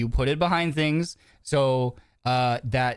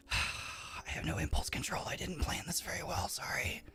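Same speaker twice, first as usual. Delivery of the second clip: sighing, then whispering under the breath; whispered aside; 5 semitones higher, 13 dB quieter than usual. Real, not acted. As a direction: confess it in a guilty whisper, as if to yourself. Whispered aside about eating food.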